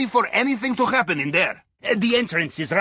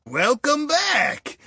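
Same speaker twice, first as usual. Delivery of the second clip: high, slow, drawn-out greeting; big welcome; 8 semitones higher, much slower than usual. Acted. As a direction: stretch out a grand welcome home. Greeting the returning crew.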